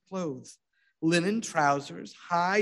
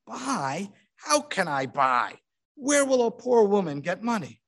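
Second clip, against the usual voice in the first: bitter complaint; 5 semitones higher than usual. A narrator's reading. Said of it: "She complains bitterly".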